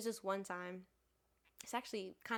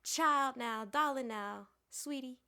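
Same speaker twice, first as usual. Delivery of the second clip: lightly voiced Southern hospitality lady, drawn-out endearments; older Southern lady; 5 semitones higher, 7 dB louder, slower than usual. Older lady about to share life advice, Southern hospitality. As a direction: address them like an older Southern hostess. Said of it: "Southern hospitality lady would just be like".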